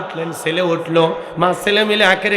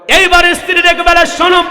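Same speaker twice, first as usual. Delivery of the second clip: loud storytelling; 8 semitones higher, 6 dB louder than usual. A narrator's reading. Preacher's story climax.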